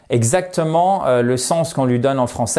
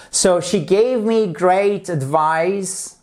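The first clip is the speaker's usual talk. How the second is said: slow model sentence; 3 semitones higher, much slower than usual. Shown as a demonstration. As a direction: read slowly, word by word. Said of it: "lesson example sentence read slowly". (lecture lente et appuyée)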